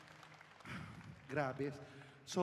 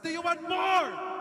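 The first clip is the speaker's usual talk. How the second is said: drawn-out high shout; loud slow insistent shout; slow shouted repeat; 13 semitones higher, 4 dB louder, much slower than usual. Acted. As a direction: stretch each word out loud. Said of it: "I said".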